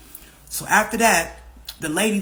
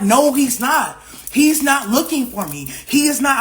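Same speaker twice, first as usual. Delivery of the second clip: loud, higher-pitched retold argument; insistent protest; 6 semitones higher, 5 dB louder, slower than usual. Daughter arguing with mom on the phone, insistent protest. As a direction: push back hard on each denial.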